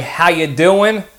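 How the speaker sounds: slow, mocking New Jersey "How you doing?"; Jersey accent. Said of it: "like Jersey trash".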